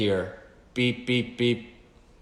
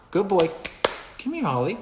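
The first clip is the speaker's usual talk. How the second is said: higher dog-praise voice, then a call; praising the dog; 10 semitones higher than usual. Retold or imitated. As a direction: reward Ollie and call him in, pitched up. Praising and calling Ollie.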